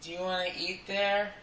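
high timid girlfriend impression; coy, high mock girlfriend. Girlfriend voiced in story.

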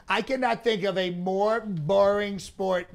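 usual speaking voice

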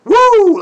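high, loud whoop of joy; a gleeful yell of delight; excited woo. Real, not acted. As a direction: let out a big happy woo. Excited cheer.

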